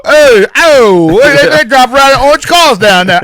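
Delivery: lightly sending up a New Yorker; mock Brooklyn accent. Father imitating Brooklyn guys, mock Brooklyn accent. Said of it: "them guys in, like, Brooklyn go".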